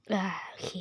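defeated whisper. Real, not acted. Character just died in game.

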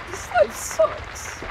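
slow high breathy whine; mock despair. Real, not acted. Gamer whining about his life, slow breathy whine. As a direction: moan it in fake despair. Self-pity moan.

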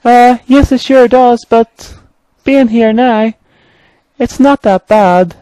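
very high, hesitant engine voice; high-pitched timid engine; nervous engine character. Acted. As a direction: squeak it out, trying to sound fine. Main Engine answers Bertram.

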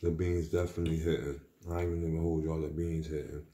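chewing between words; talking over food; mouth partly full. Real, not acted. Seen 1: Eating session; recent bite, lips move as if chewing while speaking.

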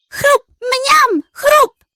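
high, loud crunch and yum noises; loud munching. Acted. Pug greedily crunching nuts, high and loud. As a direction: gobble it up noisily.

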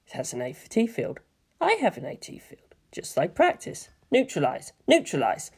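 hushed, murmuring to himself; steady yourself under your breath; quiet self-talk. Acted. Shinji to himself before battle, hushed mantra.

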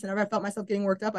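usual speaking voice

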